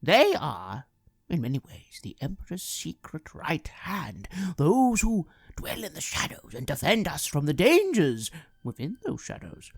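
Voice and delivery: hushed, knowing voice of a shadowy informant; sinister informant. Acted. Informant warning the listener about the Emperor's agents, sly and ominous. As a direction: confide dark secrets with a sly menace. In-character intro monologue, sinister.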